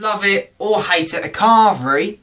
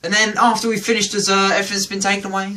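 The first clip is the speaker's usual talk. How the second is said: ordinary talk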